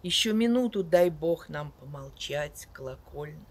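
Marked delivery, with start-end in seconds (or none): none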